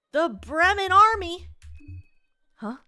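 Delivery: high, loud, slow astonished question; shocked exclamation. Acted. Game character hearing of the army, loud astonishment. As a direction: cry it out, pitch climbing in disbelief.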